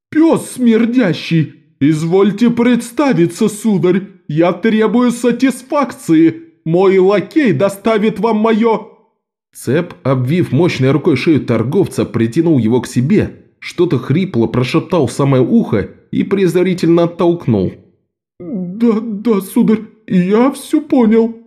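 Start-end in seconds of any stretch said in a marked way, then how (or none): 0.1-8.9 high, slow, pompous indignation; pompous outraged merchant. A narrator's reading. Merchant demands satisfaction. 18.4-21.4 high, slow, terrified babble; babbling in fear. A narrator's reading. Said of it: "залепетал смертельно побледневший торговец".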